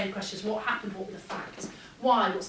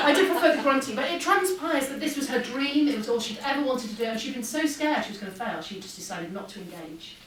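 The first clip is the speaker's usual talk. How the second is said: usual speaking voice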